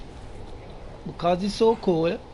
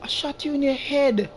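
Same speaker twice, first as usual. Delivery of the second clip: shocked disbelief; 7 semitones higher than usual. Real, not acted. Shocked at enemy surviving headshot.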